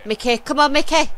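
urgent cheer. Real, not acted. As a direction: call out to your player, come on.

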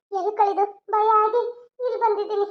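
normal talking voice

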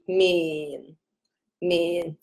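面 said low and level. Shown as a demonstration. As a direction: keep 面 down low and steady. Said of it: "只是它更低".

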